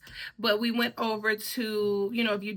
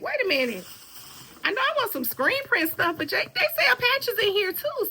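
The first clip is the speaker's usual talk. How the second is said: high-pitched, rising excitement; retold excited realization; 11 semitones higher than usual. Recalling her idea on spotting patches, excitedly high. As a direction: get carried away as the idea lands.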